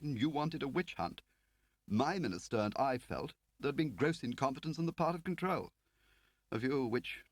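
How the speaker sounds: a prim Whitehall man; fussy official Lakin. Acted.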